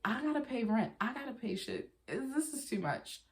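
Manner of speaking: stressed complaint. Retold or imitated. To herself about rent, high stressed venting. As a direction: groan it out like money's too tight.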